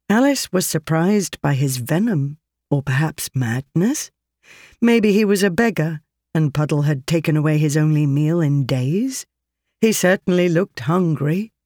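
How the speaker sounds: plain reading voice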